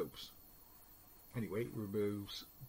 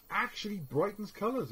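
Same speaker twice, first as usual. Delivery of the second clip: much higher, bright advert reading; 10 semitones higher, 3 dB louder than usual. Shown as a demonstration. Teacher quoting the advert's claim to the class, high upbeat ad voice. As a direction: read it like a cheerful jingle.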